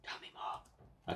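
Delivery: breathy hush; whisper. Pretend. Voicing a ghost girl, whispered. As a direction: whisper it like a secret.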